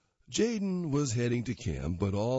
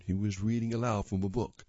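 ordinary delivery

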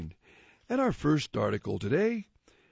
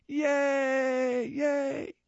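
cheering creatures; 11 semitones higher than usual. Acted. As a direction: cheer like a crowd of aliens.

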